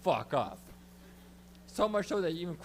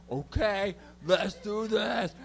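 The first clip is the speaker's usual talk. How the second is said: louder, drawn-out rallying cry; acted gung-ho; 3 semitones higher, 5 dB louder, much slower than usual. Comic retells squaring up to the woman, gung-ho. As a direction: pump yourself up, stretching each word. Psyching himself up in the story.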